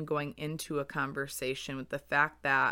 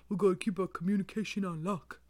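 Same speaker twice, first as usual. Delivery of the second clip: put-on man voice, quick and slightly raised; 4 semitones higher, faster than usual. Podcaster playfully telling mom they must keep talking, in a man voice. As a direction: jokingly slip into a mock man's voice. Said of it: "I always go to the man voice".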